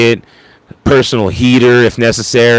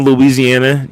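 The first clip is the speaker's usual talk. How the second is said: ordinary talk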